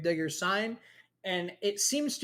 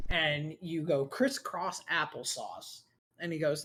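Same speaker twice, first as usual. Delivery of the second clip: normal talking voice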